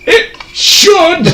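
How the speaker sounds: high, pompous insistence; hammy character voice. Acted. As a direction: insist like a self-righteous priest. DM mock insistence then laugh.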